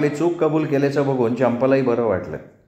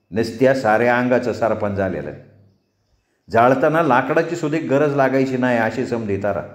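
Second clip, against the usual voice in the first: ordinary delivery, nothing marked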